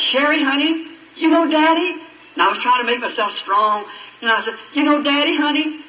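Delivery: a father trying to stay strong at the crib, high and tender; tender plea. Acted. Father to his dying baby.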